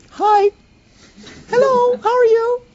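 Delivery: very high, pinched little hello; tight high greeting. Retold or imitated. A closed-off person greeting, tight and very high. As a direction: squeak out a nervous greeting. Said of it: "the opposite".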